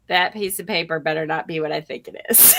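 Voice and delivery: low-voiced mock warning; wary warning. Boss eyeing the paper in her hand, wary. Boss spots the resignation letter.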